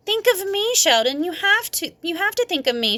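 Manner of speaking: high, pouty demanding voice; whiny annoyed girl. A narrator's reading. Lucille annoyed at Sheldon, high and pouty. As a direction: guilt him, pouting.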